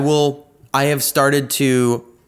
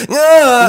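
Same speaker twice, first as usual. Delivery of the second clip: overwhelmed cry; 13 semitones higher, 9 dB louder than usual. Retold or imitated. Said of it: "my brain just goes like".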